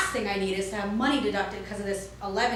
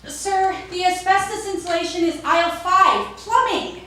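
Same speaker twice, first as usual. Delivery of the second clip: high, loud helpful clerk voice; chirpy shop clerk; 8 semitones higher, 7 dB louder than usual. Retold or imitated. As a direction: call out the aisle cheerfully. Clerk directs another customer.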